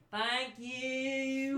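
high, sing-song thank you; two sheepish kids thanking the party together, high and chirpy; kids in unison. Acted. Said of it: "in unison and go".

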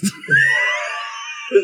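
real uncontrolled cackle, shooting way up high; helpless squealing laughter; high shrieking laugh. Real, not acted. Cracking up at own accent story.

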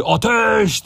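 long, high-pitched battle cry; drawn-out order to open fire; shouted command. A narrator's reading. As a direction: stretch the word out in a high yell. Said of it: "diye bağırdı".

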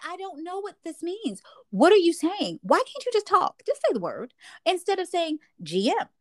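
high-pitched pleading complaint; acted frustration, pitch swooping up; exasperated. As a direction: plead with them, fed up. Acts out exasperation at acronyms.

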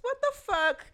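high, loud what the fuck; shocked reaction. Retold or imitated. Reliving the ex's friend request, a high shocked outburst. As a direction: squeal in mock outrage.

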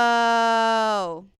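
loud drawn-out whoa of amazement; amazed whoa. Retold or imitated. Fan at the show reacting, loud amazed whoa. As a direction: let the whoa burst out, wowed. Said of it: "I literally wrote on my notes".

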